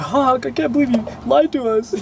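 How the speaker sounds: high, wounded mock friend; mock hurt friends complaining to Hawk; whiny friends. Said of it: "people would be like".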